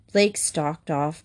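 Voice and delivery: ordinary delivery, nothing marked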